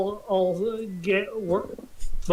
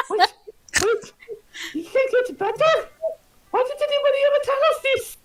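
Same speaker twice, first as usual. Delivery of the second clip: higher, excited worker voice; startled worker; 4 dB louder than usual. Acted. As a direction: gasp it out like a shocked labourer.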